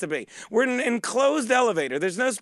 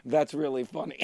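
deadpan stranger; 7 semitones lower, 7 dB quieter than usual. Retold or imitated. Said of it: "while I'm laughing goes".

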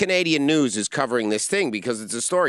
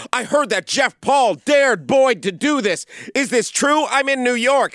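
mock gossip shout; 11 semitones higher, 6 dB louder than usual. Mimicking Jess Solomon to the hosts, loud mock shout. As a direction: belt it like a hysterical gossip. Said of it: "she's like".

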